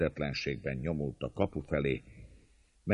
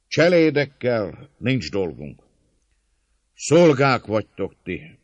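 high, raised oratorical voice; loud appeal; 7 semitones higher, 8 dB louder than usual. A narrator's reading. Attacker persuading fellow servants to open up, calling loudly. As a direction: call out to win them over. Attacker addresses defenders across gate.

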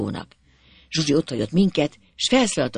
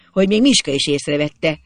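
ordinary delivery, nothing marked

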